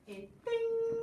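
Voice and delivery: high ting sound effect; a bright little chime; magic ting. Acted. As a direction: ring out the spell.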